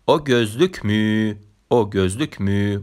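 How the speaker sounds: «او گزلوک می؟» said slowly and deliberately, repeated; teacher modelling «او گزلوک می؟» slowly for learners; slow example sentence. Shown as a demonstration. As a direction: model the Turkish question slowly, twice. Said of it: "مورد بعدی".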